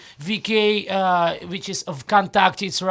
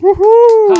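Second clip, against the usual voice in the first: loud high cheering whoop; excited whoop; 13 semitones higher, 13 dB louder than usual. Real, not acted. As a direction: whoop like greeting a crowd. Guest cheers on entrance.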